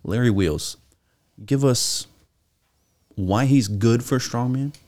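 ordinary delivery

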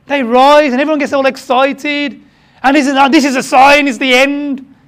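loud, high, breathless panic over signs; imitating frantic end-times believers; mock excitement. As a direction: ham up the doomsayer. Mocking excited end-times talk.

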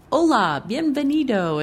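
loud, high mock-American accent in Spanish; terrible American accent. Imitating a candidate with no personal connection, terrible American accent. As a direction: butcher the Spanish with a heavy gringo accent. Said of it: "say it in a really terrible accent".

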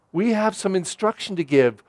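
normal talking voice

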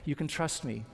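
low, soft reassurance; quiet, trust-winning tone; gentle coaxing. Acted. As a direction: coax her softly, lowering the voice. Soldier coaxing a frightened girl.

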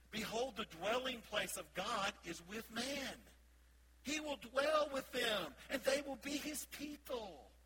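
slow, solemn proclamation; scripture reading. Acted. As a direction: let each phrase ring out slowly. Rereads scripture slowly.

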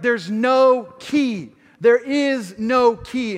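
a slow, emphatic there's no key to God; insistent. Retold or imitated. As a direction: stubbornly deny it, word by word. Arguing with God.

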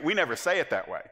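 usual speaking voice

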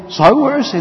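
demanding leaders. Acted. Religious leaders demanding of soldiers where Jesus is, loud. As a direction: bark it as the angry leaders.

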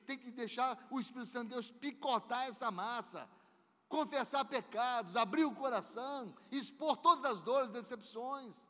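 loud, high building exhortation; fiery preaching. Real, not acted. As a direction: rise to a passionate climax. Preaching at full force.

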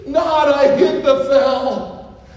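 high, loud, drawn out lament; David's slow, loud anguished lament; anguished cry. Acted. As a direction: wail it slowly as if heartbroken. Preacher acting David's anguish.

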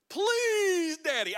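loud, high, long-stretched please, falling away; mock child's wheedling plea; begging kid. Little boy begs his father for chores, high drawn-out whine. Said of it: "He would beg his parents to let him work".

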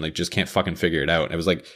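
usual speaking voice

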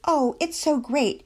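high, slow mock delight; agitated sarcasm. A narrator's reading. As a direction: stretch the words in sarcastic fake praise. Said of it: "became even more agitated".

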